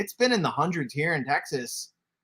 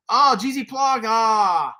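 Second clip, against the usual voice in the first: excited shout; 6 semitones higher, 6 dB louder, slower than usual. Real, not acted. Thrilled at a viewer's donation.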